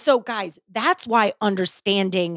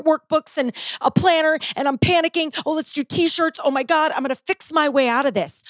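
put-on panicked old self; 6 semitones higher than usual. Host mocking her old self, higher and frantic. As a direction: play your old self spiralling into panic. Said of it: "Old [name] was".

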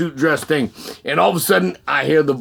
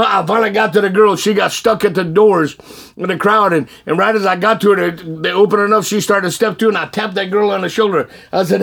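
ordinary delivery

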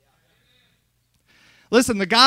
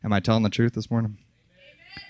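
usual speaking voice

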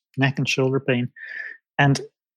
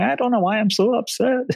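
mock tearful plea, pitched much higher; retelling a crying client, higher and upset; imitating upset crying; 10 semitones higher than usual. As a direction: play a client near tears, voice up high. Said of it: "often people crying on the other end of the phone going".

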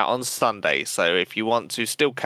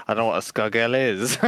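ordinary talk

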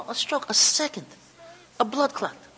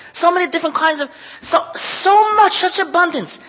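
choked up; 5 semitones higher, 6 dB louder than usual. Real, not acted. Choking up over abundance.

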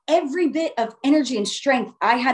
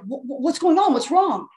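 stammered, higher mock-alarm; alarmed parents; 3 semitones higher than usual. Parents asking their stricken child what happened, alarmed. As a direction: imitate worried parents stumbling into the question.

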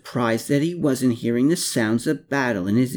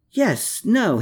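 high startled yes-no; flustered high gasp; 10 semitones higher than usual. A narrator's reading. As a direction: stumble in surprise. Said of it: "he gasped".

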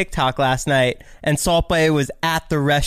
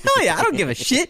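brash comeback; 10 semitones higher than usual. Retold or imitated. Retold brash comeback to friend.

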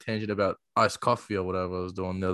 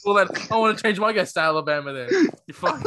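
fast, higher joking indignation; mock outrage; 9 semitones higher, 5 dB louder than usual. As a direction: play offended at the friend.

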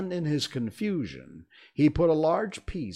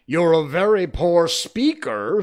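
very loud, high put-down; loud royal insult; scornful king; 7 semitones higher, 12 dB louder than usual. A narrator's reading. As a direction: boom your contempt at the witness.

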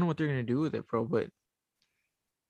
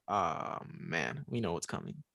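soft, low and foreboding; a quiet sense of doom; ominous low voice; 7 semitones lower, 8 dB quieter, slower than usual. Retold or imitated. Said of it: "and I was like".

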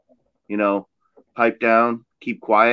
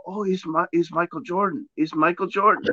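hushed, higher-pitched fan murmur; awed fans; 4 semitones higher, 5 dB quieter than usual. Acted. People at Taco Bell mistaking him for a celebrity, mock hushed awe. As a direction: softly imitate people thinking they've spotted a star.

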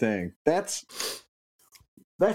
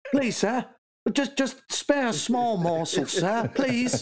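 put-on high, pleading Cockney urchin accent; begging British urchin; 9 semitones higher, slower than usual. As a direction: beg like a poor Victorian street child in a British accent. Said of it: "what's your best British accent".

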